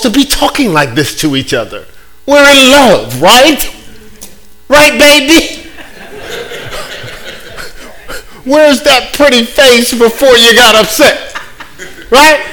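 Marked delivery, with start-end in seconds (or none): 2.3-12.4 put-on high, coaxing baby talk to a partner; mock sweet talk. Preacher coaxing an imagined partner, mock sweet and high.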